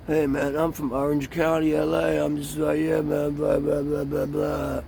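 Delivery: lightly mocking stoner dude; laid-back, spaced-out surfer voice; a hint of a dazed LA stoner; stoner drawl. Mocking drugged Orange County youth.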